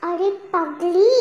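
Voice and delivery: ordinary talk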